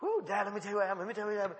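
quick, eager mock-excited chatter; imitated excited son. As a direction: rush it out like an excited kid. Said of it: "He was all excited".

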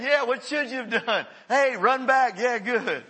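high, loud and laughing, praising the player; coach calling out. Retold or imitated. Coach praising a player who gets it right, loud with a laugh.

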